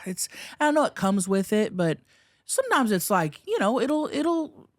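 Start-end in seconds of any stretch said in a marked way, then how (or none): 0.1-0.9 high sighing exasperation; shaky sigh. Real, not acted. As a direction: sigh it out, worn down.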